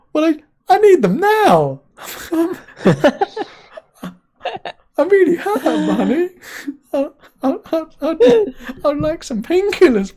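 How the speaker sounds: high, hammed-up whimper; whiny pleading husband. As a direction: beg like a big baby for pills. Husband voices his pleading self.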